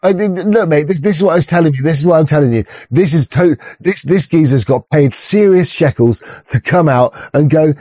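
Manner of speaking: ordinary delivery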